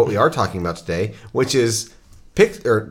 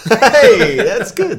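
laughing; 12 semitones higher, 6 dB louder, much slower than usual. Real, not acted. Laughing reaction to joke.

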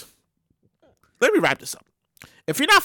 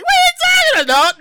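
much higher indignant protest; protesting shopper; 14 semitones higher, 4 dB louder than usual. Pretend. Caught shopper denying it, high indignant voice. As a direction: play innocent, high and loud.

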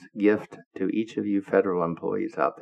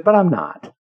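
higher, louder comeback; retold emphatic retort; 8 semitones higher, 9 dB louder than usual. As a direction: hit back firmly, acting out the boss. Secretary's retort in retold scolding.

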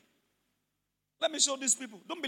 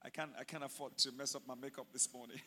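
much lower, soft, bored muttering; mocking lazy churchgoer; 10 semitones lower, 8 dB quieter than usual. Pastor mimicking a churchgoer who checks the time, low and quiet. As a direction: mimic the makeup-worried member. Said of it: "how are we supposed to pray?".

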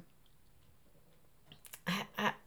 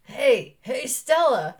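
loud, raised stage call; play character calling out; 4 semitones higher, 7 dB louder, much slower than usual. Acted. Husband entering in a play, calling loudly. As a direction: call to your wife as you enter. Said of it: "Aaron enters and he says".

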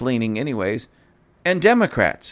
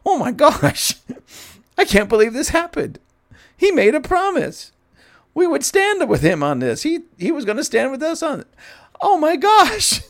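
much higher, squealing panicked Republicans; mock Three Stooges panic; 13 semitones higher than usual. Mocking the Senate Republicans for viewers, high squealing panic. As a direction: ham up their flustered disbelief. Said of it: "looking like the Three Stooges".

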